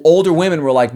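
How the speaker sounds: normal talking voice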